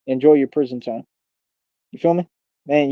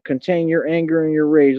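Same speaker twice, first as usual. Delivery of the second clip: normal talking voice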